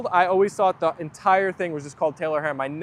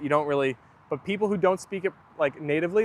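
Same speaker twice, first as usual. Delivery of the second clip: ordinary delivery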